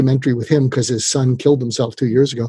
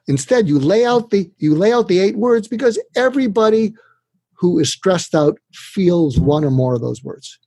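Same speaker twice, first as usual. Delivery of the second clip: usual speaking voice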